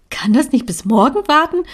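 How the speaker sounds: a mother begging her daughter to wait; pleading mother. A narrator's reading. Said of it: "hörte ich meine Mutter fragen".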